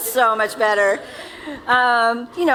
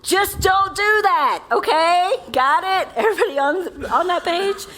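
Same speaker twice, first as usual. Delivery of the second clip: mock church scolding; 6 semitones higher than usual. Pastor mocks the church's stern warning, hammed up. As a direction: play a bossy church telling the crowd off. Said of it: "the church has often said to us".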